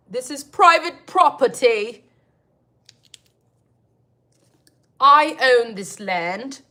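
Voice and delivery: loud, slow territorial warning; playful loud "get off my land" voice; mock landowner. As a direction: bark at a trespasser, hammed up. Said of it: "mock territorial landowner".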